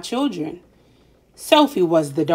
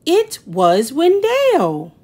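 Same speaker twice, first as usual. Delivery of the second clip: higher, slow, fed-up refrain; exasperated; 7 semitones higher, slower than usual. A narrator's reading. Narrator telling Sophie's plight, slow exasperated refrain. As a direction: sigh it out like it's always him.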